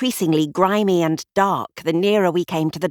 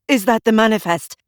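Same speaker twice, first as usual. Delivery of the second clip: louder, higher call across the dock; cried out; 5 semitones higher, 6 dB louder than usual. A narrator's reading. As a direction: call out to the official while running. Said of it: "the detective cried out".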